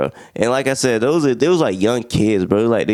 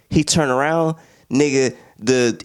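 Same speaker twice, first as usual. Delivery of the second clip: usual speaking voice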